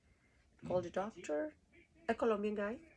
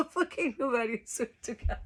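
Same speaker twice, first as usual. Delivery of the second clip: ordinary talk, nothing marked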